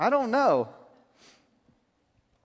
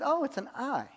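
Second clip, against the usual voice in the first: quiet, higher "Oh, it's an eye" as a soft discovery; mild realization; 6 semitones higher, 7 dB quieter than usual. Retold or imitated. Young man praying sees a picture, quietly surprised. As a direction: murmur it as if just noticing. First puzzled guess in vision.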